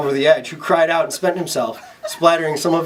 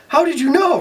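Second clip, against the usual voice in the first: surprised; 12 semitones higher than usual. Acted. Richard surprised.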